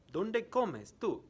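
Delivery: "¿Dónde comes tú?" said high and joined up, "comes" stressed; high, clear teacher question; quiz question in one go. Shown as a demonstration. Teacher repeating the question to students at normal flow. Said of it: "I am going to ask some questions".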